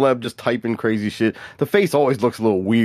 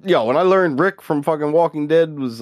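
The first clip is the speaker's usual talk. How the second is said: ordinary delivery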